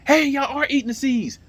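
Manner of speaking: high, loud delighted exclamation; excited calling out. Real, not acted. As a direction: cry out in happy surprise at the birds. Spots birds at his feeder.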